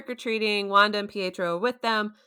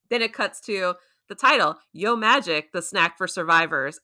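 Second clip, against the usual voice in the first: normal talking voice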